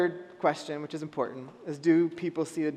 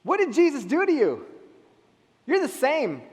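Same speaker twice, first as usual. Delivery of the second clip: skeptical challenge; 7 semitones higher, 4 dB louder than usual. Retold or imitated.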